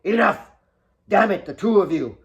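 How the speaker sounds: high, loud bellow of a father; fed-up father shouting down his daughters; furious shout. Acted. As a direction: roar to stop the fight.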